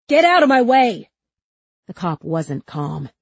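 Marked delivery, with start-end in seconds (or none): 0.1-1.1 high, loud yell; furious shouted order. A narrator's reading. Cop to Huck shielding the girl, furious shout. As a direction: shout him out of the way.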